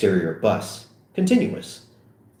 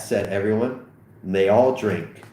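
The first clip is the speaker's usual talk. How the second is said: ordinary delivery, nothing marked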